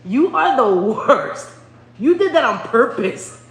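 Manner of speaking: high, loud, drawn-out accusation; loud scolding. Real, not acted. Mom to the son who scared her, loud scolding. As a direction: scold loudly, stretching the words.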